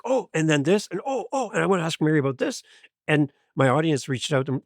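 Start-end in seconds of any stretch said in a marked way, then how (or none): 0.0-2.6 mock overeager rush. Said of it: "And I'd be like".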